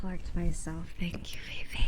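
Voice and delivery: real whispered thanks. Real, not acted. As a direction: whisper thanks trying to calm down. Whispering after sigh.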